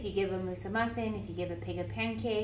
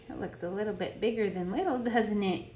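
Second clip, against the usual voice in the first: ordinary delivery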